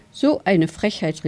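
plain reading voice

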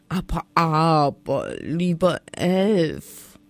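langsam, süßlich gedehnt einschmeicheln; schmeichelnd flötend. A narrator's reading. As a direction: um den Finger wickeln wollen. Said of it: "flötete die Drossel schmeichelnd". (die Drossel umschmeichelt den Elf, flötend und langsam)

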